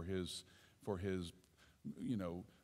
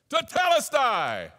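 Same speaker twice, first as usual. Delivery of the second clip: slow shouted Tetelestai, much higher and louder; triumphant shout; battle cry; 12 semitones higher, 10 dB louder, slower than usual. Acted. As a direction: proclaim victory over sin. Victory chant named just before.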